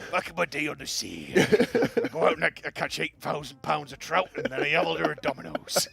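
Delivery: lightly voiced old sea dog; a hint of a salty old fisherman; gruff old fisherman. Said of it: "like a proper fisherman".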